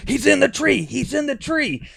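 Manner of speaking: very high-pitched frantic alert; Jim's excited voice. Retold or imitated. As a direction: imitate an excitable hunting buddy, pitch way up. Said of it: "you can just hear it in Jim's voice".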